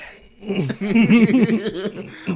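big falsetto laugh bursting out; high loud burst of laughter. Real, not acted. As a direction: crack up loudly at yourself. Laughs at own impression.

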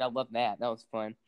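usual speaking voice

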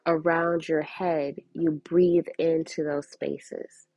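normal talking voice

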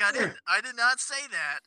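a hint of a defensive president; mock Trump denial. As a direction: friend parodies Trump denying the fish tank advice. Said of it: "he's like".

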